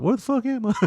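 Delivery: high, lost and bewildered; a mock panicked question; confused mock cry. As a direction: pipe it up like someone lost in a storm. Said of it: "you're like".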